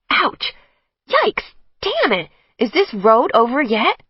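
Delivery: high yelps on bumps; jolted yelps. A narrator's reading. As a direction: yelp at each jolt. Jolted on rough road.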